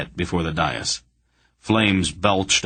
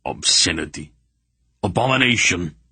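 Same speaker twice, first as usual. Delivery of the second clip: strangled outrage. A narrator's reading. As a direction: choke on your disgust. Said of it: "Relg was strangling".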